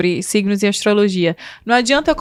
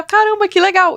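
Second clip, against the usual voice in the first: higher, delighted aside; impressed exclaim; 8 semitones higher than usual. Retold or imitated. Discovering a similar creator, higher and delighted. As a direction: sound pleasantly surprised. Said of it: "Aí quando eu vou olhar".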